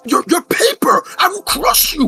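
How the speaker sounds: high, loud mock menace; threatening Red. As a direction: growl it out as a hammy threat. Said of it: "He said".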